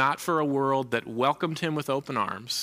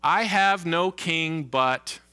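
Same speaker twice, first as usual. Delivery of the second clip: slow, open-ended prompt; 4 semitones higher, 3 dB louder, much slower than usual. Shown as a demonstration. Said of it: "How would you complete the following sentence?".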